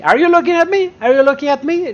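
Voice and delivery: quick repeated challenge, pitched high; movie quote challenge. Acted. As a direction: throw it out like a famous film challenge. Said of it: "very famous sentence from a movie".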